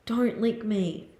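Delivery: slow, softened telling-off; subdued protest. Real, not acted. Repeats protest after yelp.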